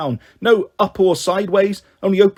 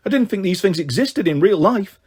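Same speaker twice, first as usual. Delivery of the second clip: plain reading voice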